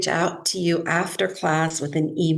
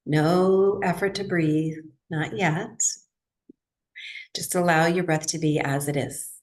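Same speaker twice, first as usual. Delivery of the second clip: guided meditation. Real, not acted. Yoga teacher settles her class into breathwork, guided meditation. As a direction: ease them gently into stillness, leaving space between phrases.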